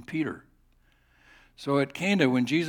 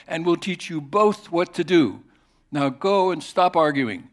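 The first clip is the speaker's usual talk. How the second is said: loud, raised, forceful rebuke; angry God; 5 semitones higher, 8 dB louder than usual. Acted. Angry God orders Moses to stop arguing, loud and raised. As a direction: thunder the order down at him. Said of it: "God got really angry".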